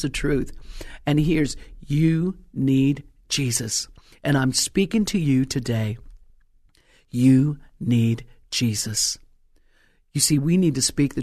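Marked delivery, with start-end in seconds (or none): 1.8-3.9 slow, weighty delivery of each word; drawn-out pronouncement; slow emphatic. Shown as a demonstration. Preacher stresses message. 7.1-9.2 slow, pointed, straight at the listener; deliberate direct appeal. Shown as a demonstration. Repeats directly to audience.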